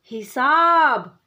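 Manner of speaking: हिसाब drawn out slowly and loud, syllables joined; slow blended word. Shown as a demonstration. Teacher tells the children the finished word, slow and drawn out. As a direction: stretch हिसाब out slowly and clearly. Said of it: "क्या बना?".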